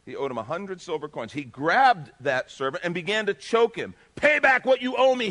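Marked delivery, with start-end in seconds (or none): 4.1-5.3 loud, high, choking fury; harsh demand. A narrator's reading. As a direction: bark the debt at him. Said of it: "he demanded".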